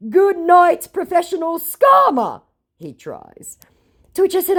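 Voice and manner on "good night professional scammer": high, loud sneering voice; mock scammer sneer. The scammer's smug parting shot, high and loud. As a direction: toss it off smugly.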